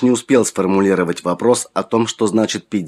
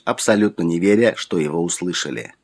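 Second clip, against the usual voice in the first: ordinary delivery, nothing marked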